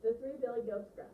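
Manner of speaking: expressive, projected storyteller; reading with voice. Shown as a demonstration. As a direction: announce the tale to a class with relish. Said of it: "notice the difference with how I read".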